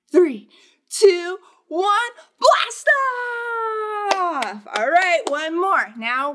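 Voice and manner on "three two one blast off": rising louder and higher to launch; building countdown. Acted. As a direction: count them down with mounting excitement. Countdown to blast off.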